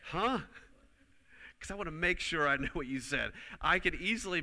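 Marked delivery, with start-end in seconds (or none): 0.1-0.4 higher, louder questioning huh; mock confused huh; puzzled huh. Shown as a demonstration. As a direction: ask as if you missed the words. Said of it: "I still say".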